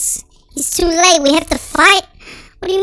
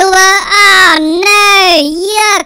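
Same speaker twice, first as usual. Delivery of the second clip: disgusted cry; 3 semitones higher, 6 dB louder than usual. Real, not acted. Disgusted at sewer stench.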